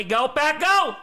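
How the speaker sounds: high, loud rally cry; cheering shout. Real, not acted. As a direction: shout it for the team. Cheering as the game starts.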